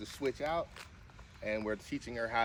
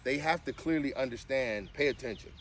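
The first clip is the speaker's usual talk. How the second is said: ordinary delivery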